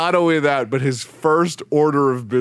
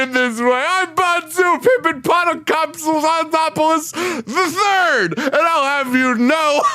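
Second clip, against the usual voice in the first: put-on pompous old man; 12 semitones higher than usual. Friend retelling a cartoon scene, hams up a pompous old man. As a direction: puff up like a pretend noble old man. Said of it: "And he goes".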